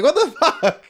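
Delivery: high, loud laughing outburst; shocked and amused at once; laughing disbelief. Retold or imitated. Finds Red Bull missing again.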